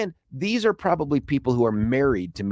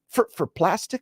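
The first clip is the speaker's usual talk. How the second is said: higher, stammered disbelieving question; mock incredulity; 8 semitones higher, slower than usual. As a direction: retell it as a baffled, high-pitched query. Retelling disbelief at reflector price.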